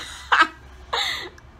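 high airy burst of real laughter. Real, not acted. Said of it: "at this point I started laughing".

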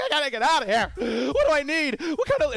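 high, loud freak-out; mock panic. Gamer imitating a player caught by the storm, mock panicked yelping. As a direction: panic in a squeaky put-on pitch, hammed up. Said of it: "You start freaking out. You're like".

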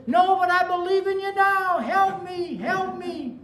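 high, loud pleading that fades at the end; man on the cliff begs God for help; frantic begging. Retold or imitated. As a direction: plead for your life.

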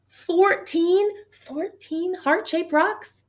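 high, loud, slow delighted reveal; thrilled at the total, drawn out; excited count. Real, not acted. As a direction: land the final number with glee.

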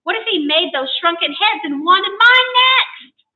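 high-pitched, agitated complaint; shouted alarm. A narrator's reading. Guest tells landlord of the tomahawk threat, alarmed shouting. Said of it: "I shouted".

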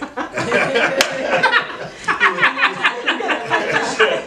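long high laughing fit; real high-pitched laughter. Real, not acted. Teacher laughs at student tally.

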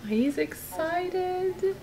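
ordinary delivery

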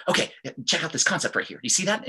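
fast, high, tense patter; stressed, quick. Shown as a demonstration. Teacher showing how stress sounds, speaking quickly. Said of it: "start to speak quickly".

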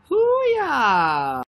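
loud, high battle cry; hooyah shout. Shown as a demonstration. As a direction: belt out the hooyah on request. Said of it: "can I have a hooyah".

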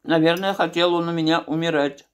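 speaking with food in mouth; muffled mid-chew speech; chewing while talking. Real, not acted. Seen 0: Bite at -9, chewing continues; jaw and lips chewing between words.

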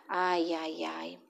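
very soft, low sighing. A narrator's reading. Narrator sighing at Cabeçudo, very soft and low. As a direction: sigh it under the breath.

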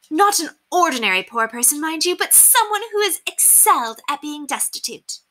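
high-pitched, affected society lady; haughty lady. A narrator's reading. Lady explaining paupers to the children, haughty. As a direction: explain it grandly, high-voiced. Narrator plays Lady Constance.

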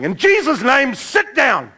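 high, loud shout of authority; re-enacted full-voice rebuke; shouted command. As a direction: order him down like an exorcist. Re-enacts commanding the disturbed man.

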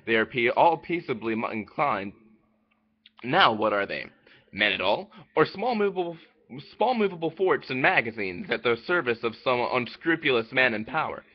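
ordinary delivery

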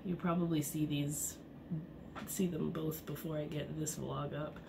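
normal talking voice

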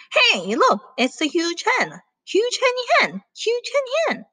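high-pitched, teasing hen chorus; cackling hens. Acted. Hens taunt the huge hen, cackling. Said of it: "The hens cackled".

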